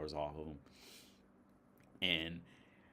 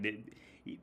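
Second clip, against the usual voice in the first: ordinary talk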